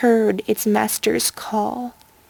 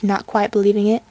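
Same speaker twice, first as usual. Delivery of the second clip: ordinary delivery, nothing marked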